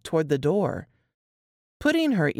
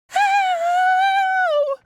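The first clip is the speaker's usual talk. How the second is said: piercing, very high yelp of pain; girl shrieking as he grabs her arm; 6 dB louder than usual. Acted. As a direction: cry out sharply in pain. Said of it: "she shrieked".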